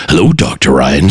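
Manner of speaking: imitating the car greeting Doctor Ryan. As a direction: greet him like his car's talking system. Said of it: "then you hear my voice".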